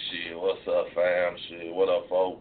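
low, laid-back thug-chick voice; mimicking thug woman. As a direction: drop into a low imitation of a thug girl. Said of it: "I don't like no woman that talk like that".

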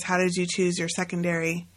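normal talking voice

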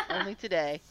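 loud laughs around the words; bursting with laughter; laughing. Real, not acted. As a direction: giggle through it. Laughing at teasing.

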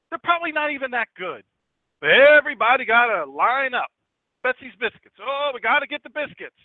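high, loud indignant tirade; angry yelling. Acted. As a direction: lay into the old lady with loud, high-pitched scorn. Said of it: "yelled at her again".

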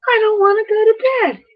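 put-on high, fast, whining little child who won't go to bed; whiny kid imitation. Host mimics kids refusing bedtime, high crying whine. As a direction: whine it like a tired toddler protesting. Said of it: "And that's just like the Huns".

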